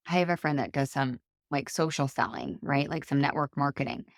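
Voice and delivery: ordinary talk, nothing marked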